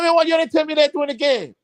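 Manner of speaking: can't believe they hid it; incredulous. Retold or imitated. Coach to his players after the game, high and incredulous.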